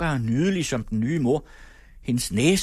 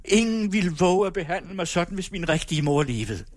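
defiant shout; 6 semitones higher than usual. A narrator's reading. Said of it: "at jeg råbte".

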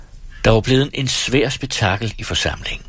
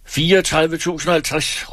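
much higher, pushing counterbid with stress on og; shouted counterbid; 9 semitones higher than usual. A narrator's reading. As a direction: top the bid with a sharp call.

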